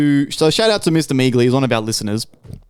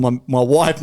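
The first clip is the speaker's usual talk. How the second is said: ordinary talk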